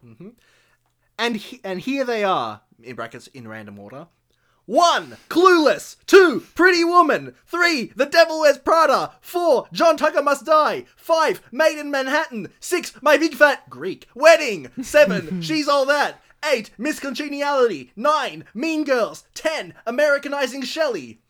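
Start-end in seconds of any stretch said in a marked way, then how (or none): 2.8-4.1 quiet aside. Acted. Parenthetical aside while reading list. 4.7-21.2 higher, louder list announcement; countdown announcer. Acted.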